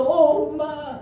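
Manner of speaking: put-on high, drawn-out childish whine; cling to mom with a mock wail; hammed-up little-kid plea; imitated clingy daughter. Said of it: "하고 붙어".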